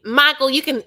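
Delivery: loud, high mimicked accent of a castmate; Angela's accent. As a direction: belt out a mock castmate accent, pitched high. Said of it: "gave Kimberly's, uh, Angela's accent".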